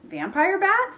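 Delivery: high-pitched, rising disbelief; startled question. A narrator's reading. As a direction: echo it back as an alarmed question. Bean startled by Ivy's claim.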